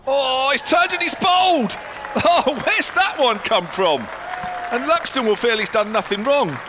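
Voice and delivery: yelling with delight as the stumps are hit; excited wicket call. Real, not acted. Commentator sees the spinner bowl the batter, excited shout.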